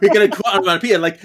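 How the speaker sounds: usual speaking voice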